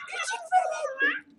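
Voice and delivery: very high squeaky voice; squeaky kid voice. Acted. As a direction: pitch way up like a toddler. Mimics tiny kid voice.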